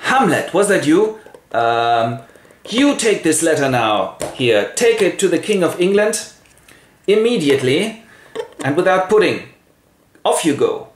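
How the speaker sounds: high, forceful royal orders; scolding king. Acted. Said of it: "the king comes in and says".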